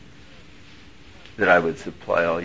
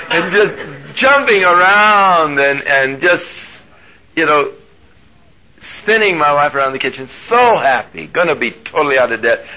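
louder, higher, bubbling over with joy; excited; 6 semitones higher, 6 dB louder than usual. Real, not acted. Preacher retells spinning his wife around, excited. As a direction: relive the giddy dance.

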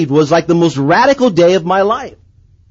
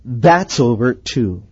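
low, slow, softer pronouncement; voice of God; 7 semitones lower, 5 dB quieter, slower than usual. Retold or imitated. God ending his biker daydream, low solemn voice. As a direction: deliver a quiet, final divine verdict. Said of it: "And I heard God say".

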